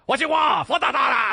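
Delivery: high, loud restaurant shout; mock screaming. Comic as Chinese restaurant staff at a customer, mock screaming. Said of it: "And everyone's screaming at you".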